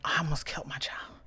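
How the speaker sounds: hushed horrified whisper. Retold or imitated. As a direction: breathe it out, barely voiced. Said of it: "I was like".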